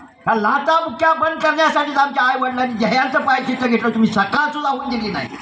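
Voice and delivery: high-pitched, outraged protest; indignant rebuke. Acted. Enraged brother rebukes the attacker.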